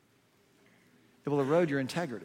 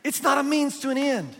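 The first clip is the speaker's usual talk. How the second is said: emphatic shout; 11 semitones higher, 7 dB louder than usual. Real, not acted. Preacher's emphatic correction.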